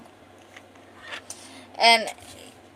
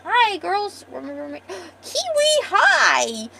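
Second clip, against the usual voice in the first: cutesy greeting; 9 semitones higher, 7 dB louder, much slower than usual. Acted.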